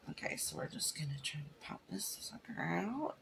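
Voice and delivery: whispered under the breath while focusing. Real, not acted. Whispering to viewers while popping clay from the mold. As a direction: hush it out as you work carefully.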